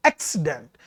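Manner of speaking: accident with the force on its opening AC; ACcident, first syllable prominent; stress on first syllable. Shown as a demonstration. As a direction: hit the AC of accident, let -cident fall away. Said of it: "तो there is stress".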